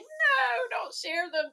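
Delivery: high, drawn-out mock plea; playful high-pitched wail of protest. Voicing Barry's protest at sharing videos.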